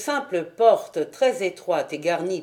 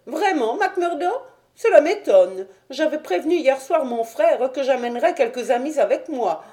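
high-pitched, put-out gentleman; high, surprised little man; Thaddeus, astonished; 7 semitones higher, 3 dB louder, faster than usual. Acted. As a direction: protest in a high voice, taken aback.

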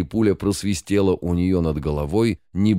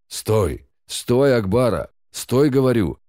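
loud commanding yell, higher; full-voiced shout; 5 semitones higher, 3 dB louder, slower than usual. A narrator's reading. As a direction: bellow after the wolf. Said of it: "закричал во весь голос Бастон".

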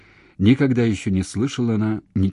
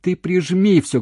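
slow, high, loud instruction; shout; 11 semitones higher, 6 dB louder than usual. A narrator's reading. Man telling her to fix the cord, shouting. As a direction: yell the instruction down the line. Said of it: "кричал он".

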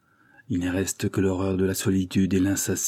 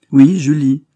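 « Oui » lancé beaucoup plus fort et plus haut; claironne-le, ivre de bonheur; affirmation exaltée; 5 semitones higher, 11 dB louder, slower than usual. A narrator's reading. Henri affirme avec exaltation. (Henri confirme à Gilbert, exalté et fort)

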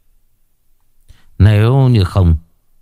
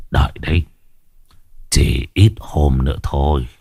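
put-on menacing old sorcerer; 6 semitones lower than usual. Sorcerer vows revenge.

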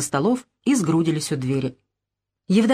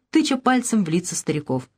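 plain reading voice

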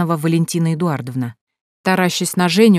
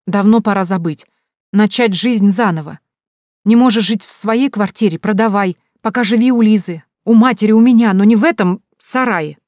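louder, raised, insistent plea; insistent urging; 4 semitones higher, 6 dB louder than usual. A narrator's reading. Sister urging brother to move out, insistent. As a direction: push him hard to give in. Relative presses him to move.

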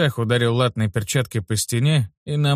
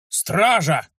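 put-on teen knight calling the guard, a sudden yell; 6 semitones higher than usual. A narrator's reading. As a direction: shout for the guard out of nowhere. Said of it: "вдруг заорал утёс".

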